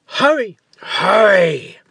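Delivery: loud, high urgent cry; urgent growl. Acted. Giant urging Leo from the dungeon, loud urgent cry. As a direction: hurry him along, impatient and fierce. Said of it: "growled the giant".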